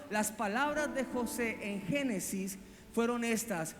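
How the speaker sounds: ordinary talk, nothing marked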